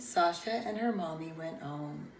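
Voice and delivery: usual speaking voice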